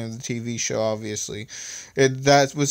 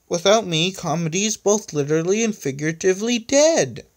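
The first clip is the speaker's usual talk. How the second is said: high, hammed-up lament; sad; 7 semitones higher, 4 dB louder than usual. A narrator's reading. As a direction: wail it in mock tragedy. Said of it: "said the disembodied voice of Electronic sadly".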